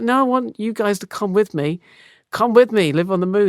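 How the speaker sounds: high, eager pleading voice; pleading Bob. Acted. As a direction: beg your mates to come along. Bob begging mates to come.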